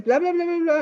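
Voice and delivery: a French flight attendant on the speaker, mock; mock French announcement. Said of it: "in French".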